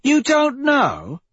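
very high, drawn-out incredulous question; incredulous echo. A narrator's reading. Victra challenging Severo's answer, incredulous.

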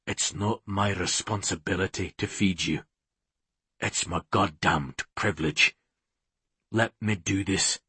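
low, soft man's voice; tender, earnest hero. A narrator's reading. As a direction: plead softly, pitched well down. Hero pleads tenderly with mate.

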